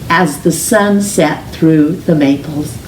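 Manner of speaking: ordinary delivery, nothing marked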